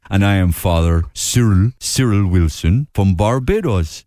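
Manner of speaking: slow Caribbean lilt with Cork in it; Barbadian-Cork accent. Father Cyril introducing himself, slow Barbadian-Cork accent. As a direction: Barbadian priest sounding like a Corkman. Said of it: "it's in Barbados because it's a, like, a Cork accent".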